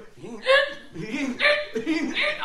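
high, loud repeated squeals; comic high-pitched squealing noise; imitated noise. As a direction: squeal it out in bursts.

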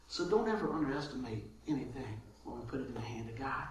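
hushed whisper. Real, not acted. Pastor whispering the closing point to the congregation. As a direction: let it fall to a whisper.

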